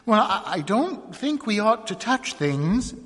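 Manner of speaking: helpless. A narrator's reading. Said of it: "a little helplessly".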